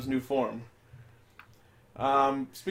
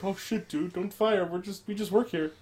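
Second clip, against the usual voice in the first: cowering; 4 semitones higher, 4 dB quieter than usual. Retold or imitated. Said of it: "shrivel down".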